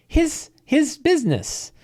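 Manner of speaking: high, put-on old innkeeper voice; old woman voice. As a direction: play the cheerfully sinister widow. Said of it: "And he's like".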